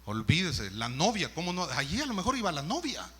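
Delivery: usual speaking voice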